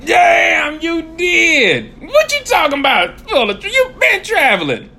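high, loud disbelief; astonished outburst. Real, not acted. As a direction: holler in amazement at your friend. Astonished at friend's list of trips.

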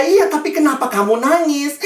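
high, loud acted question; questioning teacher. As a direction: press the child loudly for an answer. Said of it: "gurunya datang sama dia".

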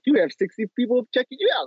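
high, mock-announcing notification voice. Mocking the LinkedIn alert to the host. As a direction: read it like a chirpy app alert.

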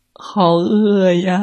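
slow, high whiny moan; nearly crying. Acted. Hungry little monster almost cries, whiny. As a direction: whine from hunger. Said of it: "小妖怪都快哭了".

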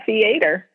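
Theater as thee-AY-ter; regional pronunciation. Retold or imitated. Quoting the kids, thee-AY-ter. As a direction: stress the middle AY of Theater. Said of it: "all of my kids call it".

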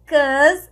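"'Cause" short, like kuz; cause reduced. Shown as a demonstration. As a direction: clip "'Cause" to one syllable. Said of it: "a gente vai falar um 'cause na frente".

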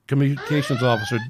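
ordinary delivery

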